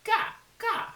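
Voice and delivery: put-on high, loud cawing baby cry; baby raven cry. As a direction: squawk it like a demanding baby bird. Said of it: "and he said".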